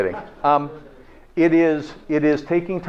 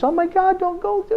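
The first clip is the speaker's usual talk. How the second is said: imitating the wife, pitched much higher; mock scream. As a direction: squeal it like a panicked wife. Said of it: "she's screaming at me".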